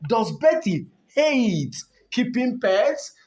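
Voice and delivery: slow, lifted reading of the last option; teacher reading the final option slowly; stress on Betty. Shown as a demonstration.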